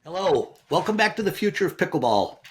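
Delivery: ordinary talk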